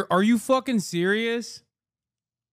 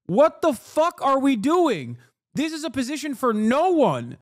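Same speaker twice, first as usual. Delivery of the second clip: raised, high-pitched angry demand; exasperated outburst; 5 semitones higher than usual. Real, not acted. Streamer fuming at Democrats banging war drums, exasperated outburst. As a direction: demand it in outraged disbelief. Ranting at his own party.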